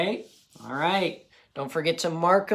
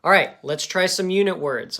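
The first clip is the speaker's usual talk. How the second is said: ordinary talk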